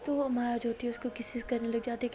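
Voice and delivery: ordinary delivery, nothing marked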